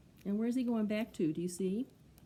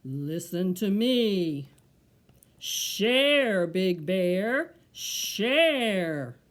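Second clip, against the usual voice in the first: low, slow, drawn-out tree call; deep tree voice; 10 dB louder, much slower than usual. Acted. The tree tells Big Bear to share, deep voice. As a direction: intone it slowly like a deep old tree. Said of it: "low voice said".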